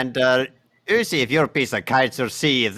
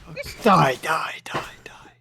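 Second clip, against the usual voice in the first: eerie unvoiced whisper of Fox Die; tiny tinkling snowflake whisper; 7 semitones higher, 3 dB quieter, much slower than usual. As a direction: breathe the words like a ghostly chant.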